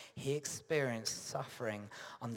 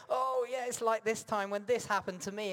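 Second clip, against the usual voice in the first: bright, higher voice of a self-absorbed friend; mock self-absorbed friend; 9 semitones higher, 3 dB louder than usual. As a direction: parody a friend cutting in with their story. Friend makes it about themselves.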